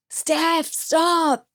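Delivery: high, drawn-out mimed shout; retold urgent call. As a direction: call after someone paddling away, held back. Retold teammate calling from behind.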